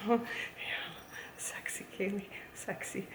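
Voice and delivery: breathy mock-seductive whisper; whispered swooning. Swooning at a favourite vlogger on screen, whispered.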